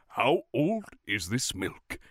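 slow, grand princely voice; stately cartoon prince, very slow; Mickey Mouse. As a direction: draw it out like a statuesque prince. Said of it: "super statuesque, like, princely character".

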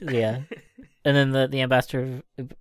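normal talking voice

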